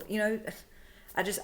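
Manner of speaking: ordinary talk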